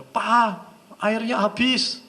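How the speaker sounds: wife calling her husband, high and drawn out. Acted. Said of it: "teriakin nggak denger".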